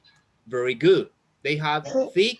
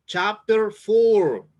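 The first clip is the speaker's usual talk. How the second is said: chapter four drawn out slowly and louder, each word weighted; slow emphatic chapter number; 4 semitones higher, 6 dB louder, much slower than usual. Shown as a demonstration. Teacher tells the students which chapter to open, slow and loud. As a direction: spell out the page so nobody gets lost.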